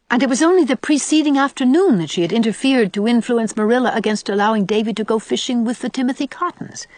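usual speaking voice

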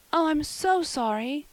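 high, drawn-out apology; penitent. A narrator's reading. Anne apologising to Marilla over the forgotten pie, penitent. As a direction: apologise as a sorry girl, slowly and high. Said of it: "said Anne penitently".

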